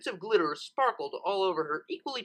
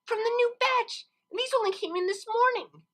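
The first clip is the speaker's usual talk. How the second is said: excited gushing; 4 dB louder than usual. Acted. Lyra gushing over the toys.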